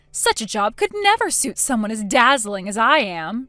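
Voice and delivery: loud, theatrical fit of wounded vanity; indignant cry. A narrator's reading. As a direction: cry it out like a diva causing a scene. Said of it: "she cried, throwing a fit".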